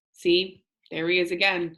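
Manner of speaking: usual speaking voice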